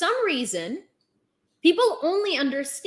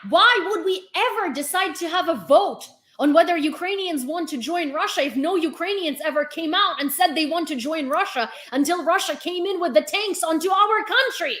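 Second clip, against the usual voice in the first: usual speaking voice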